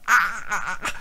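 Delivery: high, stuttering "ah" of alarm; imitated frightened yelp; mock panicked scream. Storyteller acts out fearing an attack, mock panicked scream.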